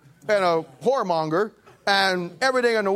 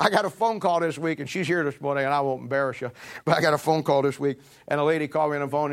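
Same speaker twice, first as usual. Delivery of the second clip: usual speaking voice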